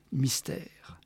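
breathy hushed whisper. A narrator's reading. Narrator hushing the mystery of the call, whispered. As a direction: whisper it like a secret.

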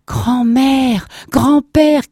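high, loud shout; crying out. Acted. Grandson calling at his grandparents' door, crying out. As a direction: call out loudly to your grandparents. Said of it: "criait Lustig".

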